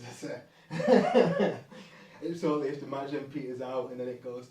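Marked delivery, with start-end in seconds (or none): 0.0-1.6 high, loud, drawn-out words dissolving into laughter; laughing. Real, not acted. Speaker amused by own explanation of bouncing fans, laughing. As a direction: crack up on your own phrase.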